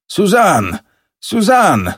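high, urgent call of the name. A narrator's reading. Father calls his daughter Suzanne from indoors, calling out. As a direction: call her name, pitch leaping up. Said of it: "zawołał".

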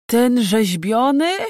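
slow, drawling, high-pitched question; dopey, drawn-out servant's query; sluggish. A narrator's reading. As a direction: ask it back drowsily and slowly. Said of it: "powtórzył wielki chłopak ospale".

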